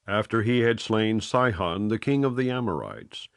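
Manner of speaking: plain reading voice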